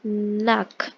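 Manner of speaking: nak as one short word, set apart; demonstrated pronunciation. Shown as a demonstration. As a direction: model nak for learners. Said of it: "So you pronounce it as".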